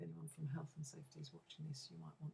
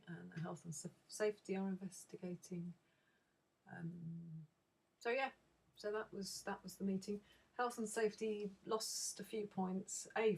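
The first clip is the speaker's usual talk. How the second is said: ordinary talk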